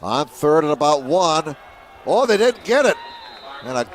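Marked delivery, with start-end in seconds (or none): 2.0-3.0 excited call. Real, not acted. Stop on fourth down call.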